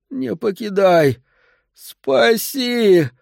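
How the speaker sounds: high, slow pleading cry; desperate plea. Acted. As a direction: beg desperately as it leaves. Old man begs departing bird.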